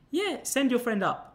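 madam urging him to send the man up; cheery madam. Retold or imitated. Phone woman again.

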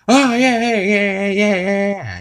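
high, loud gleeful yeahs; excited whoops. Retold or imitated. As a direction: whoop like riding a horse. Peter jumping on Brian.

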